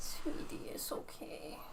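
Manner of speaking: hushed whisper to a scared cat; a soft soothing whisper to a pet. Real, not acted. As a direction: breathe the words softly to comfort him.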